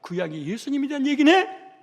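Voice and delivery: high, loud, rising discovery; acted sudden realization. Preacher voicing disciples finally grasping the Old Testament, acted surprise. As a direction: exclaim it as if it just dawned on you.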